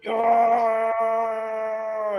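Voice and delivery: high effortful straining cry; strained grunt. Acted. Player concentrating to dim the fire, straining. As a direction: push it out like lifting something heavy. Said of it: "making these strenuous sounds".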